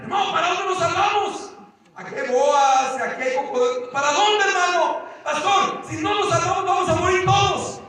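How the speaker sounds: high, alarmed protest; panicked retort. Retold or imitated. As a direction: argue back in rising panic. Acted panicked exchange in sinking canoe.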